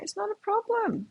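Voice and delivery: high and soft comforting; soft reassurance. Retold or imitated. Mother hugging her crying son, soft and reassuring. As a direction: hush him gently, it's fine. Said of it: "I'm like".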